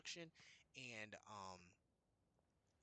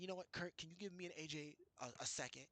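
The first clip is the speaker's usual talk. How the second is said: higher-pitched mock voice for the manager; a lightly hammed-up boss voice, higher; mimicking the boss; 6 semitones higher than usual. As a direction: lift the voice up to play the boss lecturing. Retelling boss dismissing coworker.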